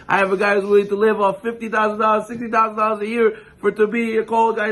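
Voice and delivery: mock divine reply, climbing in pitch; voicing Hashem. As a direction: build from mild surprise to high disbelief. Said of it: "Hashem says".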